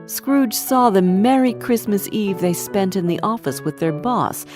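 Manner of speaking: ordinary delivery, nothing marked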